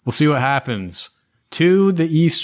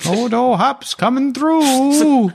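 merchant voice calling out; 12 semitones higher than usual. Acted. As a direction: announce yourself like a jolly merchant. Merchant pushing cart through crowd.

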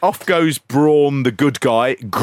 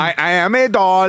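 higher, put-on doll puppet voice; weird puppet voice; 7 semitones higher, 3 dB louder than usual. Shown as a demonstration. Host shows the default voice for a doll, weird puppet voice. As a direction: talk like a generic puppet doll. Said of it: "that weird Mexican one".

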